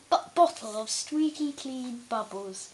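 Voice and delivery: ordinary delivery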